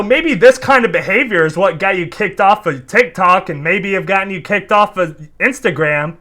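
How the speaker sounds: normal talking voice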